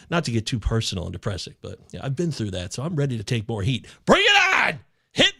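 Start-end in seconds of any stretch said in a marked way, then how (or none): 4.0-5.4 loud and high, daring them; defiant shout. Real, not acted. Host daring fantasy critics to hit him, a defiant shout. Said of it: "challenging critics".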